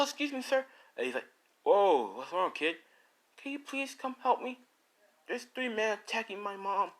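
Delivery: put-on panicked boy. Izuku begs the police officer for help, high and urgent. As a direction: kid pleading for help.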